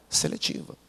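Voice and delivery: whispered punchline. Real, not acted. Preacher whispering the punchline to the congregation. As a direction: breathe the word out.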